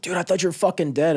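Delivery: high, loud relieved disbelief; relieved shout. Retold or imitated. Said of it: "He's like".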